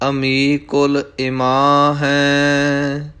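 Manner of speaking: slow, sustained devotional chant; scripture recitation. Acted. Teacher reciting scripture for learners, slow chanting. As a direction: recite measuredly, drawing out each line's last syllables.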